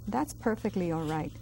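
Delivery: ordinary talk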